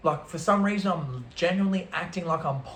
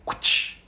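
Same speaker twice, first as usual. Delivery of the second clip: unvoiced imitated blade whoosh; knife swish. Storyteller shows a big knife cutting hash, imitated swish. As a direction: mimic a knife slicing with a sharp rush of air.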